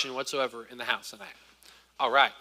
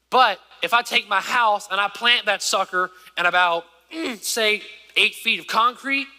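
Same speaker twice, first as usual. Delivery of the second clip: rising, insistent sermon build; preacher's climax; preaching; 4 semitones higher, 4 dB louder than usual. Acted. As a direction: drive home the cornerstone.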